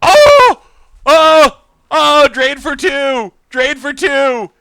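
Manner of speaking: yelling in sheer delight at the win; too excited. Real, not acted. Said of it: "I'm too excited".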